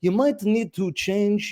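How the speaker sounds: mock Russian Bond villain, talking shop; Bond villain accent. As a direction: imitate a Russian-accented city engineer. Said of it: "Sounded like a James Bond villain".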